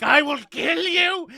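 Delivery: loud slow furious yell; shouting threat. Retold or imitated. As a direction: roar it at them. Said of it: "Temperance is like".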